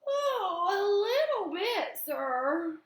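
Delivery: high, slow put-on yawn through the words; boy faking sleepiness to dodge questions; fake yawn. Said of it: "I yawned real big".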